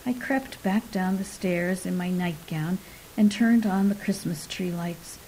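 ordinary delivery

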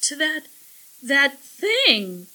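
upset, rising to loud disgust; tearful sniffing. A narrator's reading. Millie points out the alien to Jake, sniffing. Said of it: "Millie sniffed".

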